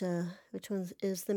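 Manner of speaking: ordinary talk, nothing marked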